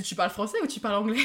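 laughing. Retold or imitated. Telling a friend about her bad English accent, laughing loudly. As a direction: crack up while quoting him. Retelling friend's confused question.

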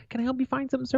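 high, soft imitation of a helpful clerk; lightly mocked high clerk voice; store worker.

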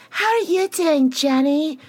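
very high put-on voice; hammed-up high-pitched imitation; mock high greeting. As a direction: sing out a high, exaggerated greeting. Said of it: "And then Jenny's like ... and she goes".

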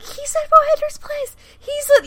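very high excited squeak; mock fangirl squeal. Fans work out where Siler is, squealing excitedly. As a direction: re-enact a thrilled discovery way up high. Re-enacts fans' excited discovery.

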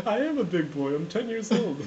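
put-on loud, high indignant kid; childish protest. Man insisting to interviewer he is grown, childish protest.

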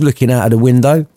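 ordinary delivery

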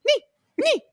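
high, loud, squeaky echo of Ni; minions echoing Ni. Retold or imitated. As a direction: squeak it out like a chorus of little minions. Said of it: "And then everyone just goes".

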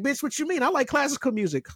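high, sassy mock voice; mocking sassy retort. Sassing the coworkers about music, high and mocking. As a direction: fire it back in a high sassy put-on.

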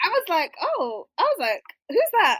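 gushing over the accent; excited. Real, not acted. Reacting to the accent.

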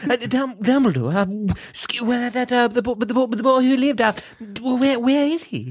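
badly faked, halting old wizard; Dumbledore. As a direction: stammer along like Porky Pig. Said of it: "That was the worst impression".

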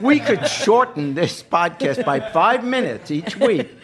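slow dry tease. Real, not acted. Dry teasing of co-host.

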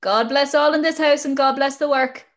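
high sing-out greeting on entering; neighbours' greeting. Retold or imitated. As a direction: imitate old neighbours calling a blessing into the house. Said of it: "they used to come in and say".

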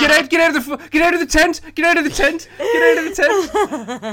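high, loud frantic yelling, laughing through it; retold panicked scream. Scared boy yelling at an imagined imp in the tent, frantic scream. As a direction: shriek it like a terrified kid slashing at a tent. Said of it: "And then Adam Good screamed".

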